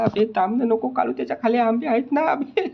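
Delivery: very high, loud cry to stop; desperate squeal at a friend; panicked plea. Acted. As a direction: plead as the secret slips out. Secret mangoes about to be exposed.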